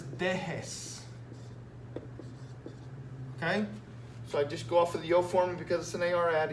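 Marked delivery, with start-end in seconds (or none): 0.0-1.0 dejes said slowly, syllable by syllable; slow, clear answer. Shown as a demonstration. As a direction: spell out dejes slowly for students. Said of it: "my answer is".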